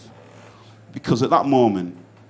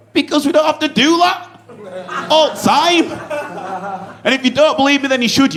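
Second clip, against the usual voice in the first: high, loud proud boast; proud punchline; 12 semitones higher, 3 dB louder than usual. Real, not acted. Comic boasting to crowd about Northern friendliness. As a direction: crow it proudly.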